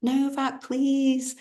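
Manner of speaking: high calling out to him; high, eager call of Novak. Retold or imitated. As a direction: call his name across the court. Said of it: "I just shouted out".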